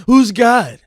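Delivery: high, loud sceptic's question; mock challenge. Imitating sceptical people's challenge.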